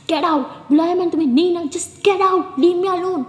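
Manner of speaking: angry shout. Acted. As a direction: throw the manager out, yelling. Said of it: "गुस्से में उसे भी निकाल देती है". (गुस्से में ज़ोर से चिल्लाना)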